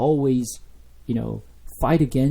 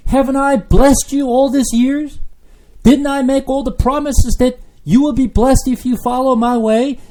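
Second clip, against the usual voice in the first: loud, high pleading as God; God pleading loudly; 10 semitones higher, 7 dB louder than usual. Acted. Preacher voicing God to his people, loud and high pleading. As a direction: cry out hurt to his people.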